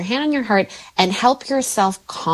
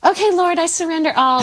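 high, lilting pious surrender; sing-song saintly calm; mock serene prayer; 10 semitones higher than usual. Mother mocking instant holy calm, high and lilting. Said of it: "jump straight into a".

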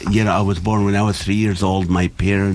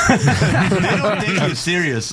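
laughing through the line; amused, words breaking into laughter; laughing as he talks; 10 semitones higher, slower than usual. Real, not acted.

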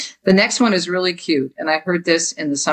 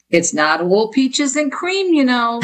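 slow, higher-pitched Jersey mom; mom's saying; 6 semitones higher, slower than usual. Retold or imitated. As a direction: draw it out high like mom's adage. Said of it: "That's what my mom was saying".

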